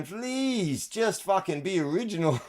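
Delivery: high pleading plea with a laugh; pleading mock voice. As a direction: beg them, high and half laughing.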